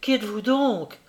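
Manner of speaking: plain reading voice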